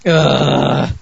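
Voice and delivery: loud, drawn-out "ugh"; disgusted groan. Real, not acted. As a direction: groan at the very mention of it. Groans at the film named.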